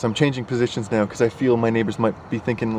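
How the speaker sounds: ordinary talk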